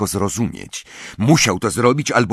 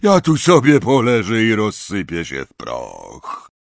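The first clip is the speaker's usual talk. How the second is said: drawn-out feeble groan, sinking at the end; woeful, slow lament; weak moaning; 4 semitones higher than usual. A narrator's reading. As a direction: moan it out, starting high and fading. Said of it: "jęknęła słabym głosem".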